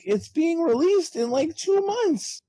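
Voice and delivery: squeaky, slowed-down disbelief; exasperated disbelief. Retold or imitated. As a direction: drag it out high, in disbelief. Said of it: "I'm like".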